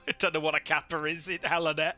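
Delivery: normal talking voice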